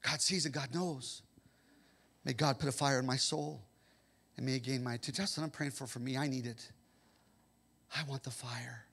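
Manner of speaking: soft, low prayerful murmur; quiet prayer. Real, not acted. Preacher quietly praying for fire in his soul. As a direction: pray it quietly, inward.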